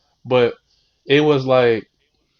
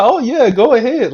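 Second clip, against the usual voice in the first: mocking, high-pitched go-ahead; sarcastic high mock approval; mock eager permission; 10 semitones higher, 5 dB louder than usual. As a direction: parrot the pushover boyfriend, high and louder. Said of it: "be like".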